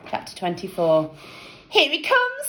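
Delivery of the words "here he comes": put-on girl voice. A narrator's reading. Girls watching Jeff.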